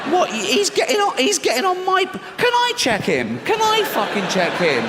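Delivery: high, alarmed outburst of suspicion; panicked flyer. Acted. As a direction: lose your cool in mock panic. Acted panicked passenger on plane.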